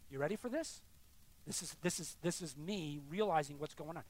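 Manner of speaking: normal talking voice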